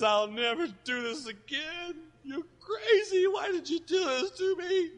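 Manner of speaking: crying and yelling high, slow at first; sobbing wail. Retold or imitated. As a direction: wail the blame through tears. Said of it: "speaking in another voice".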